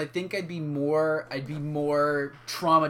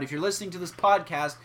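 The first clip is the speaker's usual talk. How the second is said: usual speaking voice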